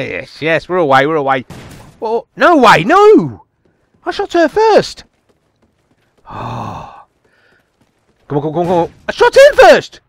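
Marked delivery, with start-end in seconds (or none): 2.0-5.0 high-pitched gamer protest; outraged disbelief. Real, not acted. Protesting a lost kill in game. 9.1-10.0 loud, very high protest; indignant yell. Real, not acted. Protesting being killed in game.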